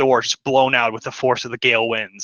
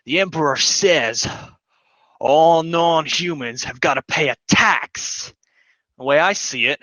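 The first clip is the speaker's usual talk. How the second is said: threatening soldier captain; 3 semitones higher than usual. Acted. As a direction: lean on them for the tax. And he says.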